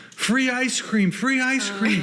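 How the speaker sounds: high, drawn-out vendor's call; ice cream announcer. Acted. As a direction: call out free treats to the street, stretched and high. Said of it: "they're announcing the ice cream shop".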